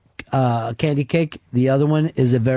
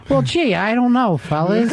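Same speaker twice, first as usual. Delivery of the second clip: high, goofy cartoon cat; lightly voiced timid cartoon cat; 6 semitones higher than usual. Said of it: "that cartoon cat voice".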